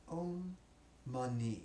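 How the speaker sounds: drawn-out, evenly held syllables; syllable drill. Shown as a demonstration. Teacher leading students in syllable stacking, drawn-out drill. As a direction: model it slowly for repeating. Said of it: "Now three syllables".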